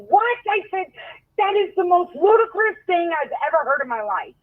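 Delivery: high, incredulous outrage; can't believe what she's hearing; indignant disbelief. Retold or imitated. Outraged at cop's rule.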